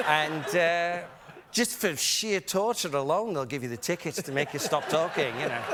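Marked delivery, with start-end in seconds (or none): 1.5-5.4 mocking imitation of English scorn; English relatives. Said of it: "my relatives".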